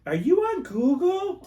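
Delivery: high, slow, loud telemarketer pitch; mock telemarketer. Scam caller pitching a listing, high mock sales voice.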